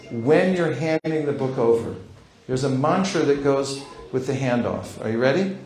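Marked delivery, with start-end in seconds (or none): none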